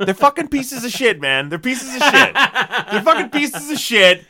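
loud, high laughing rant. Real, not acted. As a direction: rant it out while cracking up. Ranting about the filmmakers.

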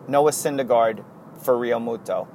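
ordinary talk, nothing marked

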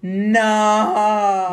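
loud, high wailed "no"; mock horror. Girl spots dad in the plaza, mock horror. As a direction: wail it in hammed-up dismay.

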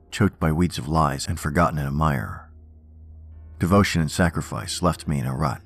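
usual speaking voice

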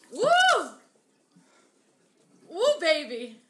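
loud high whoop; excited whoop. Real, not acted. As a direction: whoop it out through the burn. Burning heat hitting.

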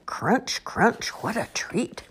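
slow crunching critter; lightly voiced aardvark.

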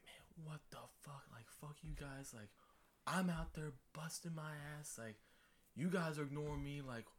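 hissed, breathy high rant at teammates; angry cussing out. Retold or imitated. Bursting into the room to cuss out teammates, whispered fury. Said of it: "just started just cussing them out".

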